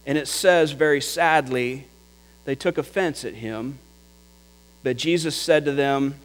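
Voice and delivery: ordinary delivery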